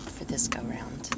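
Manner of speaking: soft whispered aside; whispered tag after announcing her final confession. Real, not acted. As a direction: slip the phrase out in a whisper.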